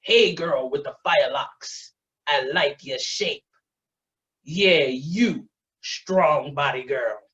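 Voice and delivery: low, drawn-out catcall; slow, leering street catcall; a man leaning on every word to pick up a woman. Retold or imitated. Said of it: "And the voice is like".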